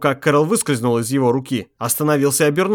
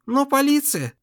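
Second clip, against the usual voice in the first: dazed wife voice; 11 semitones higher than usual. A narrator's reading. Wife protests dreamily.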